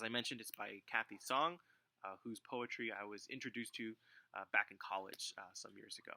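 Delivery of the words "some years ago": normal talking voice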